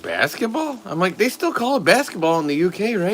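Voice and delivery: high-pitched teasing disbelief; mock incredulous. Teasing the British guy at the airport, in high mock disbelief.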